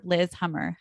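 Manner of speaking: normal talking voice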